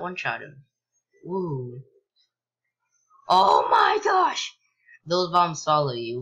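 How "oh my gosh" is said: very high, loud, drawn-out cry; startled shriek. Real, not acted. Player is startled by bombs chasing them, shrieking. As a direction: shriek it slowly in shock.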